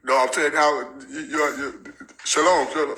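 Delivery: ordinary talk, nothing marked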